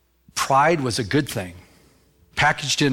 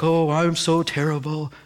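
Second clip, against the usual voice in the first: mock self-pitying moan; drawn-out, woeful whine of false humility; slower than usual. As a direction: play someone wallowing in self-pity. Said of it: "some people are like".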